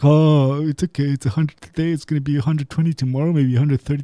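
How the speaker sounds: mocking the clueless. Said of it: "They're like".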